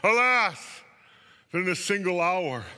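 high, loud wail on each "alas"; loud, keening dramatic lament. A narrator's reading. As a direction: cry it out like a theatrical mourner. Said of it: "weeping and wailing and saying".

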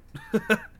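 high, loud burst of laughter; real high cackling laugh. Real, not acted. Laughing after retold outburst.

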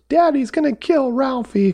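very high mock kid wail; squealed movie quote in a child's pitch; wailing child imitation. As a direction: imitate a distraught little kid. Quoting child's favourite movie line.

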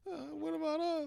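high, whiny little plea; playful fake-crying whine; mock pleading. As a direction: whimper it like the left-out one. Mocks left-out Little Haiti pleading.

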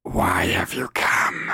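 rasping stage whisper; menacing witch. Acted. Witch challenges the visitors, menacing whisper. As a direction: question them in a creepy hoarse whisper. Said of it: "make my voice sound like that".

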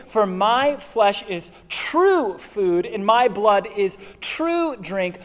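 slow, loud, solemn reading; scripture reading. Acted. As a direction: read it out slowly with weight.

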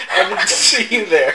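blurted loudly through laughter; laughing, loud joke. Acted. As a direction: crack up while saying it. Player joking to the imp.

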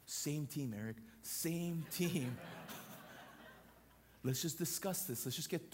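level, gentle reminder; wife calmly heading off an argument, gently. Retold or imitated. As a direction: settle him before a fight. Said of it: "She was like".

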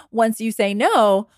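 normal talking voice

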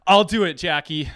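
acted burst of keenness; eager outburst. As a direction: jump in and call out eagerly. Jumps up to volunteer.

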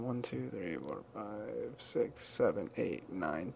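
soft counting to himself; counting under breath. Real, not acted.

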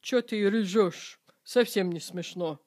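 deflate the laughter flatly; not amused. Shown as a demonstration. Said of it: "when you're not amused".

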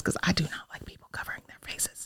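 breathy whisper, no voice; whispered aside. Real, not acted.

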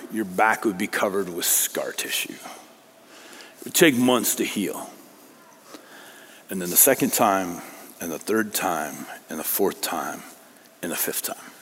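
low, soft, sinking almost to a whisper; hushed gravity. Real, not acted. Preacher making listeners picture repeated floggings, hushed gravity.

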